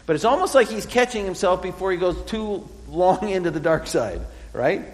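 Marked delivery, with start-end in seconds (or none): none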